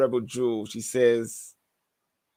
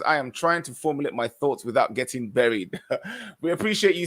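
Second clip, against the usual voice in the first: normal talking voice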